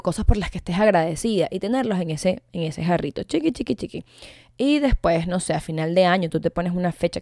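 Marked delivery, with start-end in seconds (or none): 3.2-4.0 muy aguda y juguetona, como algo diminuto; vocecita aguda. Acted. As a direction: pinta lo pequeñito con voz de niña. Diminutivo para el jarrito. (vocecita aguda y tierna)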